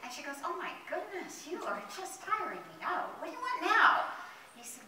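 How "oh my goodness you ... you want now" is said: high-pitched weary exasperation; exasperated. Acted. Leaf fairy tired of the caterpillar's wishes, exasperated. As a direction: build to a loud, fed-up question.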